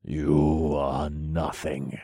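slow, seething contempt; tightly held anger, drawn out; through clenched teeth. A narrator's reading. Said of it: "I said through clenched teeth".

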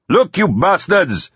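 high, drawn-out angry yell; angry shouting. A narrator's reading. Teddy yelling at the people in the manor, shouting. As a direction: shout slowly across a distance. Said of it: "Teddy was shouting".

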